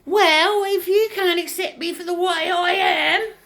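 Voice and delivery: hammed-up offended squeal; mocking indignant falsetto. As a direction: declare it in a squeaky outraged voice. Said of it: "It's just".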